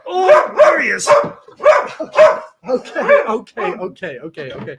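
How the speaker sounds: very high, loud delighted cry; excited squeal. Real, not acted. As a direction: squeal at the cat showing up. Cat appears, excited.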